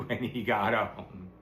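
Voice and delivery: ordinary delivery, nothing marked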